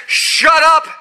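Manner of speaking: loud, high command; sharp rebuke. Acted. Jesus to the wind and waves: loud sharp command. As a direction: snap it at the wind.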